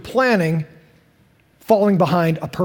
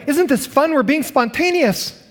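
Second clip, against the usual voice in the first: high-pitched, oblivious delight; mock cheerful enthusiasm; 7 semitones higher than usual. Stranded road tripper making the best of it, high and mock-cheery. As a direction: chirp it as if disaster were fun.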